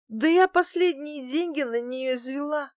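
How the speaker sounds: high, crushed little protest; stricken babble. A narrator's reading. Woman mourns her thrown-away bottle, high stricken babble. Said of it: "пролепетала я сражённо".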